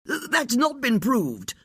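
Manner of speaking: high, slow, stammering bark; barked denial. A narrator's reading. Lord Harliford rounding on the detectives, barking. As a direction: snap back in defence, tripping at the start. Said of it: "Lord Harliford barked".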